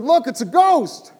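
high, loud frightened cry; terrified shout. Acted. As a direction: shriek it out like you've seen a ghost. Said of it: "And they're all terrified".

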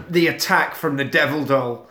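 normal talking voice

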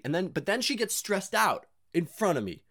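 ordinary talk, nothing marked

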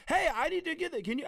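high, loud urgent request; pushy, high-pitched mock mom; imitated nagging mom. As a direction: mimic mom springing a last-minute errand. Mom's sudden urgent retold request.

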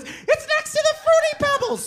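very high squeal; imitated frantic kid. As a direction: call it out in a mock kid voice. Mark calling after his brother.

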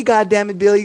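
ordinary talk

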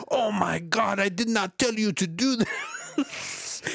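mock Indian accent, high, loud and slowed, panicked. Scammer finds the money gone, panicked mock Indian accent. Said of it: "In my best Indian accent".

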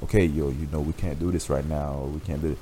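low, soft, calm reasoning; calm and quiet. Retold or imitated. To his angry girlfriend, calm and quiet. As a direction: keep it low and soothing, don't rise. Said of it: "how I'm talking right now".

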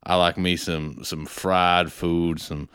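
put-on lazy country-boy Texas twang, pitched low; Texas accent. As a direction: put on a deep, down-home Texan voice.

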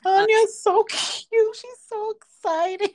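very high-pitched adoring squeal; gushing. Real, not acted. Fan fawns over cute little Anya, gushing. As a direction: gush over something adorable.

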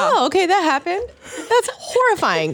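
high-pitched, laughing mock horror; mock horrified. Listener reacting to shocking audiobook stories, mock horrified. Acts out hearing shocking book passages.

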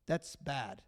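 quiet, dismissive shrug of a line; indifferent shrug. Retold or imitated. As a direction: say it like it's no concern of yours. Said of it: "and you're like, "Huh".

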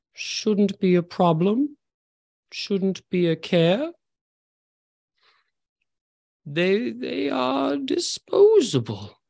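high, slow, drawn-out menacing voice; sinister villain. Acted. Unseen villain dismisses the cloaked figure's worry, slow and sinister. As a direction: purr it slowly like a cold antagonist.

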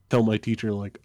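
ordinary talk, nothing marked

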